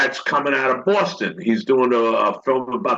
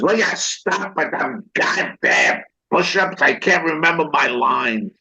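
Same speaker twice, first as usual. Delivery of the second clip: Sirico; 2 semitones higher than usual. Retold or imitated. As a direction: imitate an actor fed up mid-play. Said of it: "Sirico looked at me. He says".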